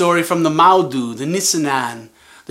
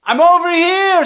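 high, loud answering call; calling back; 9 semitones higher, 8 dB louder than usual. Acted. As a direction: shout back from across the distance. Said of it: "said the other voice".

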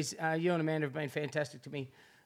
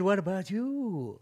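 drawn out slowly, half laughing; slow mock quote; 2 semitones higher, much slower than usual. To a guest, slowly mocking a question nobody asks. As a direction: ask it slowly as if nobody ever does. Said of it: "Who has anyone said".

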